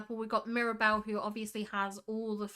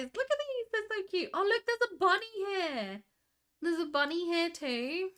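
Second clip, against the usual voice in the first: high-pitched squee over cute little creatures; cooing delight; 6 semitones higher than usual. Real, not acted. Streamer spotting tiny frogs and a bunny, cooing. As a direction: coo over them in a high voice.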